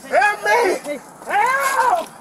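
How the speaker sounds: desperate shouts for help; real panicked cries for help; fluttering cries for help. Real, not acted. Man pinned down by officers.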